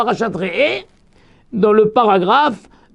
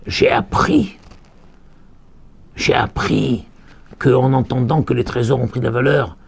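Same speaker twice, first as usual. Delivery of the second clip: normal talking voice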